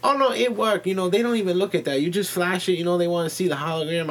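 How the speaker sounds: insistent, high shop-lady imitation; Chinese counter lady. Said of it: "And she go".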